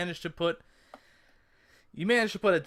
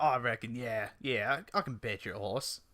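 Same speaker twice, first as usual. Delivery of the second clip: low, wobbly Cockney attempt; low mock-Cockney drifting toward Australian; 6 semitones lower, 7 dB quieter than usual. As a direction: agree in an amateur Cockney accent. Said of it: "I was trying to go Cockney".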